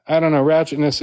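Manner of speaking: normal talking voice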